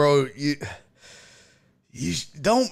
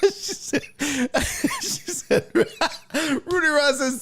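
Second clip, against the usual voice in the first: trying to speak while cracking up; high words broken by laughs; real giggling fit; helpless laughter; 8 semitones higher, slower than usual. Real, not acted. Cracking up retelling a message.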